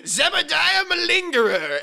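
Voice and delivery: high, slow, hammy villain name; villain announcer voice. Pretend. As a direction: announce him like a melodrama villain. Naming a cartoon villain.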